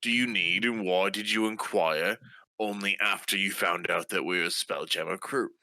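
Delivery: ordinary talk, nothing marked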